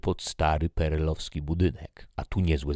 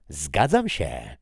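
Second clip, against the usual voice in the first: slow, loud exclamation; exclaimed; 7 dB louder, slower than usual. Acted. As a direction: blurt out agreement with force. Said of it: "wykrzyknęła śmierć".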